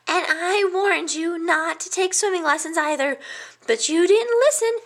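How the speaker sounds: a nervous little hedgehog listing past disasters; fretful little hedgehog. A narrator's reading. Hedgehog friend warning the pig about his decisions, high fretful voice.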